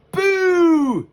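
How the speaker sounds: sudden high shouted boo; spooky shout. Acted. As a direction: jump out and yell it to scare her. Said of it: "he shouted".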